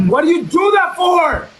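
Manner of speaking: high-pitched exasperated demand; exasperated outburst. Real, not acted. Confronts opponent after losing.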